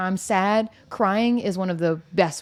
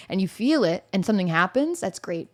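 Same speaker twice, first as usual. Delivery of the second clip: usual speaking voice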